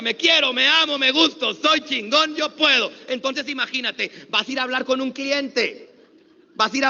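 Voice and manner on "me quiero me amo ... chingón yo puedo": shouted, proud affirmation; loud confident chant. Shown as a demonstration. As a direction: belt it out with pride. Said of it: "Más fuerte".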